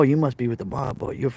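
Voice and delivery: ordinary talk, nothing marked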